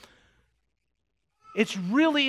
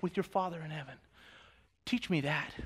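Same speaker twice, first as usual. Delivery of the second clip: soft, low turn toward prayer; hushed lead into prayer; 6 semitones lower, 7 dB quieter than usual. Real, not acted. Pastor quieting the church before prayer, soft and low. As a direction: drop down gently to lead the prayer. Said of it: "Let's pray as a church".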